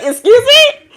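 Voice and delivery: laughing, indignant "Excuse me?" at getting up before sunrise; loud mock outrage. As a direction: throw out "Excuse me?" in playful disbelief. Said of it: "It's like".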